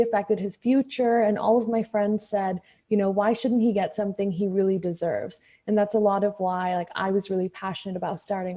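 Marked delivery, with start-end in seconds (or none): none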